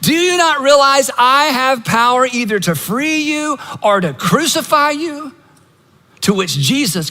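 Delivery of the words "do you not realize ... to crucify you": high-pitched, pressing questions from an exasperated governor; exasperated demand. Retold or imitated. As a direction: demand an answer, fed up and rattled. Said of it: "He finally is so exasperated".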